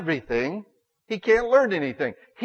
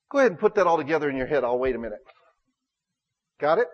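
usual speaking voice

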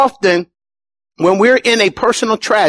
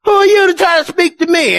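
indignant believer; 10 semitones higher than usual. Retold or imitated. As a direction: bristle at being corrected. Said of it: "we're like".

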